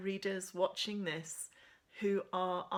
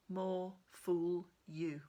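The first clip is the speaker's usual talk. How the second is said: more fool you; much slower than usual. Acted. Scarlett's sullen self-mocking retort.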